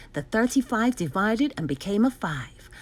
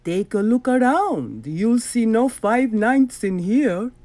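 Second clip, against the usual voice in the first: louder, slow, smug villain voice; 2 semitones higher, 6 dB louder, slower than usual. Acted. Doctor Brock taunts the hero, slow and smug. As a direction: gloat slowly like a sly doctor.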